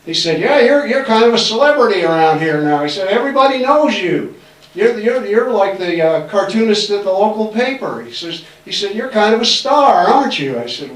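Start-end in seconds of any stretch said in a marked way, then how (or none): 0.4-2.8 raised, louder mock praise; butter him up with a knowing lilt; higher, louder mock-flattering principal; teasing flattery. Principal flattering, building trap. 3.1-8.0 raised, louder mock praise; butter him up with a knowing lilt; higher, louder mock-flattering principal; teasing flattery. 8.9-10.6 raised, louder mock praise; butter him up with a knowing lilt; higher, louder mock-flattering principal; teasing flattery.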